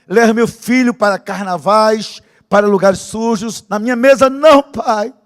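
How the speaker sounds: loud, high pleading prayer; shouted prayer. Retold or imitated. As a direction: plead with God at the top of your voice.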